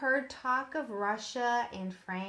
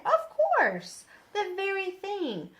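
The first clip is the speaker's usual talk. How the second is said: much higher, louder burst of delight; eager old doctor; 10 semitones higher, 5 dB louder than usual. Acted. Delighted doctor agrees to teach Nate, high and eager. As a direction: jump at the idea, pitch leaping up.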